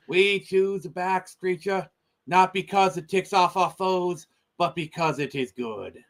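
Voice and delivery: put-on a barely passable Kennedy accent, slowly; JFK impression. Delivering the moon speech to a stream buddy in a passable Kennedy accent. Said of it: "a barely passable J F K impression".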